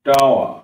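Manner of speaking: "টাওয়া" ending on an open a, no r; loud, falling "টাওয়া" with silent r; dropped final r. Shown as a demonstration. Teacher modelling tower without its r, "টাওয়া" ending open. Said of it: "লোপ পেয়ে যাবে যার মানে".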